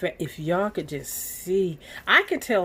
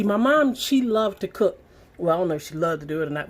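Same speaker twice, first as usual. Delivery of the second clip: ordinary delivery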